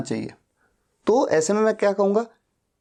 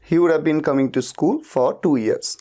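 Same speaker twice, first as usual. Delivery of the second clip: slow model sentence; 2 semitones lower, much slower than usual. Shown as a demonstration. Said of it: "teacher models English sentence".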